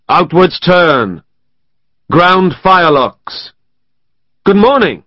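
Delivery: loud, high, slow parade commands; officer drilling sepoys on the parade ground. A narrator's reading. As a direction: call each order out to the company.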